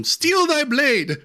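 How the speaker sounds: high, drawn-out mock-medieval cry; slow, high-pitched olde English battle line; archaic knight talk. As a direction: proclaim it like a hammy knight of old. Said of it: "not gonna talk like that".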